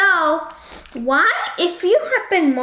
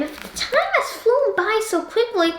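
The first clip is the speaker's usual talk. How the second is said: surprised; 7 semitones higher than usual. Real, not acted. Exclaims how fast time went.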